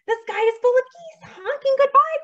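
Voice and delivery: high, loud, urgent announcement; cried out. A narrator's reading. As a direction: shout the news to a sleeper. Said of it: "cried Ladybug".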